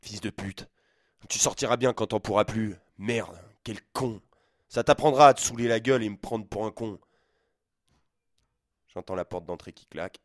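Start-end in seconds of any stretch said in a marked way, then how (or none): 0.0-7.0 loud furious tirade; father storming out, yelling curses; father shouting. Acted. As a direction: hurl insults on the way out. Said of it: "sort en hurlant".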